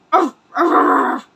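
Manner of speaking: a few quick mock barks; imitated dog barking. Streamer imitating his late dog's barking, light mock barks. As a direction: bark like a small yappy dog. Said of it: "he was always barking".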